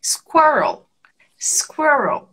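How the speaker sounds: "Squirrel" modelled with the L on the roof of the mouth; tongue-up final L. Shown as a demonstration. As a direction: say "Squirrel" carefully, falling onto a clear final L. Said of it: "com a língua lá no céu da boca".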